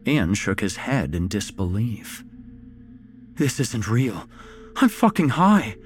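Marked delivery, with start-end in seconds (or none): 3.4-5.8 rising, louder, high panic; panicked. A narrator's reading. Ian, surrounded, panicking louder and higher. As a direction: spiral into panic. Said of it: "he whispered to himself".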